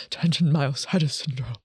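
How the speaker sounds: a nervous laugh through the words; self-conscious giggle on the medical name. Real, not acted. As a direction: laugh at stumbling over the term. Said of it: "so nervous".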